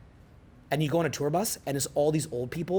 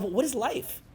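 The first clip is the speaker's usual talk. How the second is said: higher, wondering voice; bewildered musing; 8 semitones higher, 4 dB quieter, slower than usual. Retold or imitated. Alone in nature asking himself: higher bewildered wonder. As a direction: ask it like you just woke up to life.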